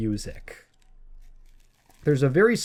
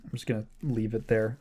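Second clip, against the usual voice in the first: usual speaking voice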